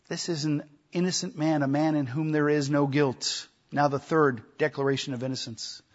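ordinary delivery

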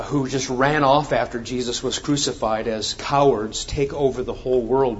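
normal talking voice

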